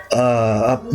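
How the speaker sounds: ordinary talk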